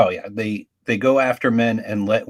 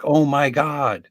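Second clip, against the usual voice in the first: put-on shocked kid; 5 semitones higher, 6 dB louder, slower than usual. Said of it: "I was like".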